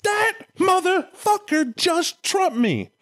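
high, slow, outraged whine; a jealous deity squeaking in disbelief; hammed-up offended God; indignant God. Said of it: "God's now going".

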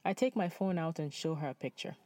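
usual speaking voice

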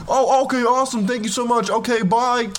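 high, eager mock teen boy; thrilled boy. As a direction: play a giddy kid who got a yes. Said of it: "Jimmy thrilled".

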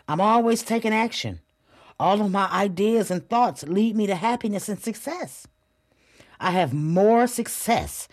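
firm, upbeat affirmations said aloud; confident self-talk; affirmation demo. Shown as a demonstration. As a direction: proclaim each line like you own it. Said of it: "That's how I need you to talk to yourself".

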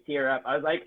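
ordinary talk

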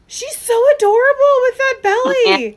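high, loud squeal of adoration; gushing. Real, not acted. Gushing over the baby bump.

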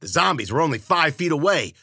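usual speaking voice

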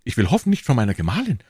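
high-pitched, flustered questioning; alarmed husband. Acted. Prisoner fearing news of his wife, high and flustered. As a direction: jump in anxiously, voice shooting up.

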